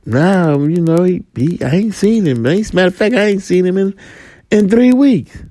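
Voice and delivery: high, fast, offhand denial; street friend. Acted. Friend answers about missing partner.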